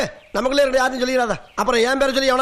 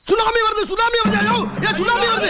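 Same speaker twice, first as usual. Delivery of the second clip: fake tsunami panic; 8 semitones higher than usual. Man fakes tsunami to empty queue, high panicked shouts. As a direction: scream that the tsunami is coming to scatter the queue.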